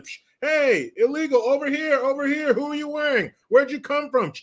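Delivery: high-pitched red-carpet photographer calls; quick, high, insistent mock paparazzo; paparazzi. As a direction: pester them like a pack of paparazzi. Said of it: "Maybe have some paparazzi down there".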